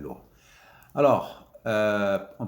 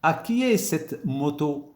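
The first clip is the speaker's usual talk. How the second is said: slow model question; 7 semitones higher, 4 dB louder than usual. Shown as a demonstration. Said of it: "comme petit modèle ici".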